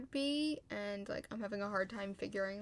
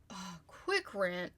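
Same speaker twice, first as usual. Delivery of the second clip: ordinary delivery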